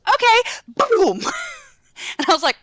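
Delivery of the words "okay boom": much higher, breezy okay; a cheerful toddler agreeing, then ignoring it. Retold or imitated. Aunt imitating her nephew's carefree okay before he hits again. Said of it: "And he goes".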